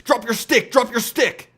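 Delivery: high, loud frantic yelling of a pro player; Eternal Envy impression. Quoting Eternal Envy's famous clip, high frantic yell. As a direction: bark it like a panicked pro mid-fight. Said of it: "it's the one where he's like".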